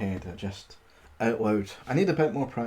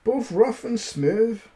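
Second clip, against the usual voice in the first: high, loud mock growl; a scared giant blustering; 7 semitones higher, 5 dB louder, slower than usual. Credulous giant growls at the boasting tailor, hammed-up. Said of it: "growled the credulous giant".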